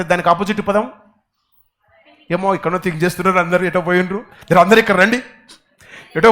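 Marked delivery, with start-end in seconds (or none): none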